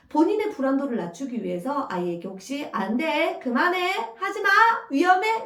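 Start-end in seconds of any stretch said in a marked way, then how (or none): 2.7-5.5 rising, higher and louder with each warning; a worried parent stopping a child, getting shriller; anxious parent warnings. Retold or imitated. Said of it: "라는 말을 달고 계신 부모님".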